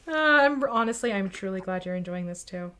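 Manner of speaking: ordinary delivery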